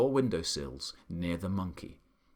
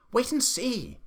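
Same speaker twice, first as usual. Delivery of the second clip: much higher, gleeful call; shouted; 11 semitones higher than usual. A narrator's reading. The monkey calling down to the Duke, shouting high. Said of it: "shouted the monkey".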